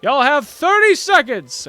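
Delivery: loud announcer call to the archers; announcer shouting time to the competitors. Acted. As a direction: bellow the time limit across the field. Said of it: "and he goes".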